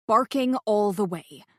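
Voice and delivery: usual speaking voice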